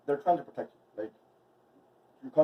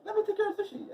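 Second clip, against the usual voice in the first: much higher retold plea; mock own voice; 3 dB louder than usual. Telling how she asked her mom, in a high mock voice. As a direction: squeak it out as a mock quote. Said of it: "I'm like".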